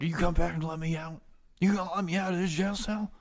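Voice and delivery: high-pitched prisoner pleading to get out; locked-up prisoner. Acted. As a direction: call out from a cell in a put-on voice. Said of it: "Barack, is sitting there like".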